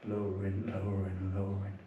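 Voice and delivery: soft, low, steady chanting of each movement; meditative noting. Acted. Noting during prostrations.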